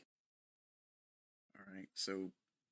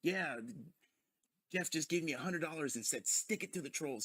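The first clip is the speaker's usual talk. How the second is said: mock streamer voice, a bit higher and louder; imitating DSP; 6 semitones higher, 3 dB louder than usual. Vlogger mocking DSP's gloating on stream, higher and louder. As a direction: hams up DSP gloating about the hundred dollars. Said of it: "And then D S P".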